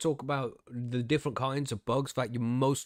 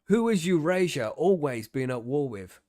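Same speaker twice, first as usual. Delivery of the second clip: emphatic, raised mock-interrogation answers; mock loyalty test; 3 semitones higher, 6 dB louder than usual. Host parodying his re-education test, mock loyalty drill. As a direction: drill it loudly like a party catechism.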